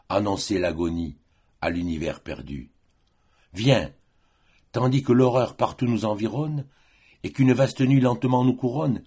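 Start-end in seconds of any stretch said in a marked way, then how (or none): 3.5-9.0 higher, lifted declamation; impassioned summons. Acted. Lover calls the beloved as horror surrounds them, impassioned summons. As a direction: call your beloved to you in urgent verse.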